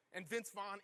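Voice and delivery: normal talking voice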